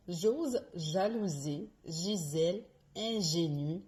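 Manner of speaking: "J'ose jalouser" slowly, J hushed and voiced; slow Z and J drill. Shown as a demonstration. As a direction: lean into every J. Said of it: "Encore une fois, sans rire". (virelangue lente, J bien appuyé)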